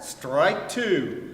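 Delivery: loud umpire call; umpire's call. A narrator's reading. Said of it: "and the umpire said".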